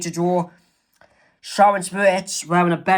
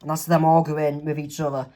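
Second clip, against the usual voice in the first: ordinary talk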